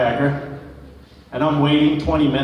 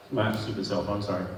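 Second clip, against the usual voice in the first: ordinary talk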